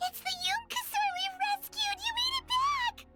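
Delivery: high, excited squeal of recognition; overjoyed. Acted. Greeting the Yunkasaur we rescued, overjoyed. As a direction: burst out in high-pitched delight. Delighted reunion with rescued creature.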